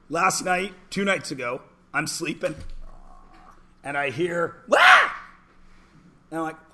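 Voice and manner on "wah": sudden high, loud shriek; scream. Retold or imitated. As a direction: mimic a kid's startled night-time scream. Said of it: "why'd you scream last night".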